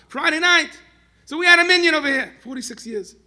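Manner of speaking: high, booming exclamation; impassioned shout. Real, not acted. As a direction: cry it out to the whole hall at full force. Preacher bursts out mid-story.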